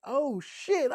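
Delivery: ordinary delivery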